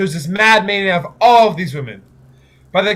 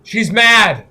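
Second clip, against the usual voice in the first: alarmed shout; 4 semitones higher, 6 dB louder than usual. Acted. Greek reacting to her outburst.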